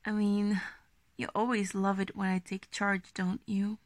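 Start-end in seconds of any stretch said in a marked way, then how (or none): none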